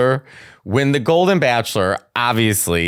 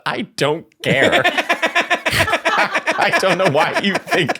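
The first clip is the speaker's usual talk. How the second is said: laughing retold rant; 7 semitones higher than usual. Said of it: "I stopped and said".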